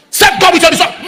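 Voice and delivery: high, loud shouted refrain; preaching shout. Shown as a demonstration. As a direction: shout it up at the congregation. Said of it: "builds the repeated refrain".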